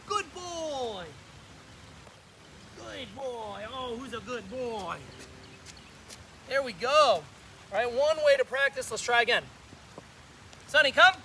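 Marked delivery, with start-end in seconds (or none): 0.1-5.0 soft praise. Real, not acted. Praising dog softly. 10.7-11.1 loud, high recall call. Real, not acted. Calling dog by name.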